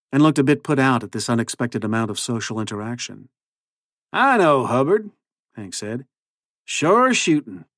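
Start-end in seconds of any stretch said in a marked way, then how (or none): 4.1-5.1 high, drawn-out cowboy drawl; cowboy accent. A narrator's reading. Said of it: "his ridiculous new accent". 6.6-7.7 high, drawn-out cowboy drawl; cowboy accent. A narrator's reading. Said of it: "his ridiculous new accent".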